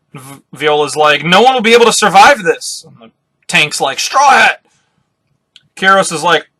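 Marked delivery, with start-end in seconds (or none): 1.2-2.8 raised, louder cry of alarm; acted dismay. Character reacts to looming disaster. 4.0-4.6 high, loud shout of a name; acted urgent call. Character calls out to another.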